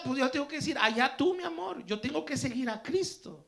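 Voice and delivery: loud, high-pitched, driving sermon delivery; forceful preaching. Real, not acted. Preacher explaining the verse to his congregation, forceful preaching. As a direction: drive the point home to the congregation.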